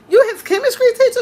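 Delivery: high, loud, fast, laughing question; surprised question. Retold or imitated. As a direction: blurt it out amused and surprised.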